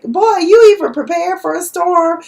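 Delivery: high, loud, incredulous rant; indignant mock lecture; exasperated loud scolding. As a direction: scold him for not preparing for the storm. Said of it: "he was like".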